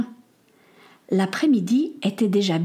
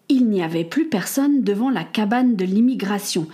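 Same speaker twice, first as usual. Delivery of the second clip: plain reading voice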